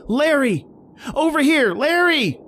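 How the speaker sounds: shouting through a blizzard. Acted. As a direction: yell to draw him away. Shouting to lure him out.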